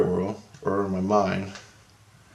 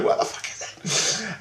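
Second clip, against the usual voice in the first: softer, slow, breathy curse; exasperated under the breath; hissed frustration; 10 semitones higher, 5 dB quieter than usual. Retold or imitated. Frustrated, left out.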